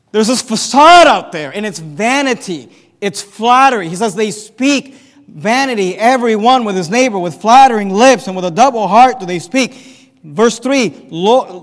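loud, ringing sermon voice; preaching at full force. Acted. Preacher reading the psalm aloud to the church, loud and ringing. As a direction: proclaim the verse to the hall.

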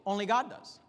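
ordinary talk, nothing marked

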